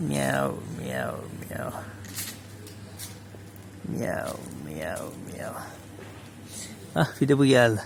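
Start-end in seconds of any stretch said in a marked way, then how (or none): 0.1-1.7 soft meow imitation; hushed kitty meows; imitating a meow. Meowing back at the cat. 3.9-5.5 a low, hushed meow; lower, quieter kitty meows; low meow imitation. Meowing back at the cat.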